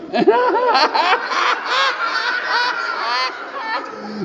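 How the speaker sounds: put-on very high, falling childish giggle; little boy bursting into giggles. Shown as a demonstration. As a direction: giggle like a little boy who can't stop. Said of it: "Can you do it?".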